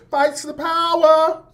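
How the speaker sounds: high, drawn-out limp-fisted chant; mock a militant slogan, slow and high; limp power slogan. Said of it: "the fist was a little limp".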